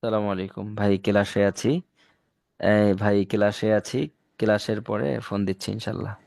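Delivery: hushed phone answer; whispering on the phone. Real, not acted. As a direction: keep it low, class is listening. Takes a phone call during class.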